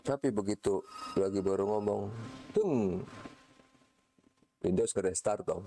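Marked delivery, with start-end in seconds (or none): 2.5-3.0 high, sharp "tung" like a system beep; crash sound effect. Pretend. Lecturer mimics the sound of Windows suddenly restarting. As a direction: make a sudden beep as the computer dies.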